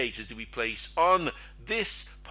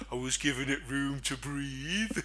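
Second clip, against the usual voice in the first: a softer, put-on voice for the lame excuse; mock trader excuse; 4 dB quieter than usual.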